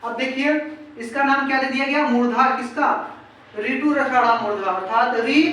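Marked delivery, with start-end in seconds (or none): none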